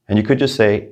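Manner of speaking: ordinary delivery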